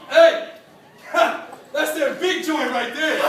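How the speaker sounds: loud, high, slow boast; cocky older kid. Retold or imitated. Naked eighth grader taunting the small boys, loud and boastful. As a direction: show off like the big man of the locker room. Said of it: "and he'd go".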